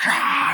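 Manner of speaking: sharp breathy scream of fright; gasp-scream as the scarecrow moves; imitated fright scream; mock scream. Scarecrow comes to life.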